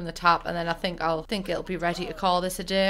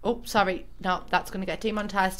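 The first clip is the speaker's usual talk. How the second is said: usual speaking voice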